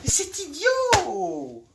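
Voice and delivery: high, very drawn-out exclamation; exasperated scorn. Acted. Monologue-hater dismissing the tragedy just recited, exasperated cry. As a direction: stretch the last word in derision.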